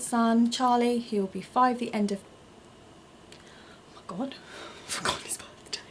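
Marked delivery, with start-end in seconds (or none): 3.3-5.8 surprised whisper. Real, not acted. Realises she forgot son's birthday.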